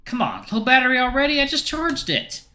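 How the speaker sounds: high, exasperated complaint; exasperated and pitched high; exasperated grumble. Acted. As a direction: whine at a phone that has let you down. Protagonist grumbling at phone battery.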